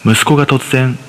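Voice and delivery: usual speaking voice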